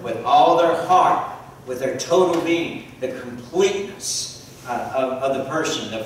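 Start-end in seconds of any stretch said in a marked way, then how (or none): 0.0-1.4 "all" drawn out and loud, slow; stress on all. Real, not acted. Preacher repeats the congregation's answer, stressing "all" loudly. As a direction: lean hard on the word "all".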